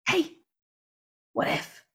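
soft voiceless whisper; inner whisper. Retold or imitated. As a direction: breathe it like a tempting thought. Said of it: "that whisper will come knocking".

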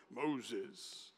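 low, soft voice of God calling out; deep booming God, toned down to a hush. Acted. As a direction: sound like God calling Moses. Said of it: "I do a deep voice".

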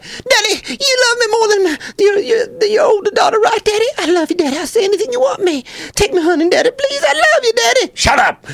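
put-on very high pleading child's whine; whiny little girl Liz Cheney. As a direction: beg daddy like a spoiled kid. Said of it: "she was like".

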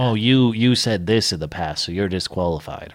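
lightly voiced smug know-it-all; a hint of a sneering nerd; snooty nerd voice. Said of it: "my generic, like, snooty nerd voice".

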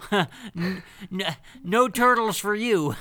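high, loud, barking Soup Nazi refusal. Retold or imitated. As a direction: bark the refusal like the Seinfeld soup man. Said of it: "ancient soup Nazi".

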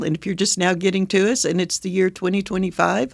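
normal talking voice

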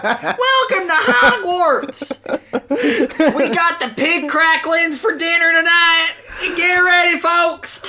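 high folksy twang, laughing through it, shouting at the end; southern Reverend. Acted. Reverend welcoming Harry to dinner in a hammy southern twang. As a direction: call folks to dinner like a hillbilly preacher.

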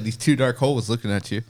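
usual speaking voice

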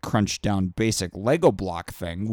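usual speaking voice

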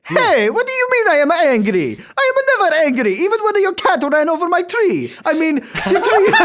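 high, loud hammed-up Italian fury; angry Italian. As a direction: bluster like a furious Italian caricature. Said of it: "Can you be as angry as possible as an Italian?".

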